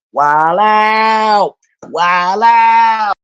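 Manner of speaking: loud, high, drawn-out holler; wilding out. Pretend. Streamer predicting Trump's lame-duck antics, comic loud holler. Mimics Trump going wild in office.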